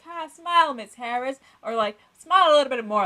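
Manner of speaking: high, loud sarcastic teacher voice; mock him telling her to smile. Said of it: "like, sarcastic".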